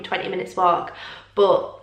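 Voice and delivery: ordinary talk, nothing marked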